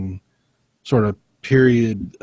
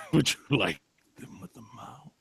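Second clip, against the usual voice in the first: whisper; 8 dB quieter than usual. Acted. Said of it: "inside Sam's thoughts".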